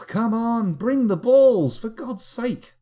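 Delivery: loud, high, drawn-out exasperated call; exasperated plea. Pretend. Player begging the game to bring the balls, exasperated. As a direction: call out impatiently, high and stretched.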